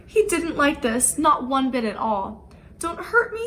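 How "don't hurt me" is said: high-pitched frightened plea; whimpered. Acted. Terrified lion begging the little mouse, whimpering. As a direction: beg like a big cat shrinking into a ball. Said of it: "he whimpered".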